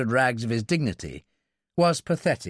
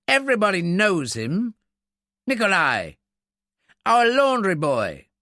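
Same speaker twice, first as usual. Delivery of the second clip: exasperated, higher-pitched explanation; indignant snort; 7 semitones higher, 5 dB louder than usual. A narrator's reading. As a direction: snort it as common knowledge. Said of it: "Briggs snorted".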